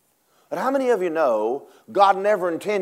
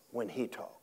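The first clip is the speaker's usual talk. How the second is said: whisper; 5 semitones lower, 17 dB quieter than usual. Acted. Preacher drops to whisper.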